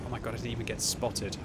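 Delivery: usual speaking voice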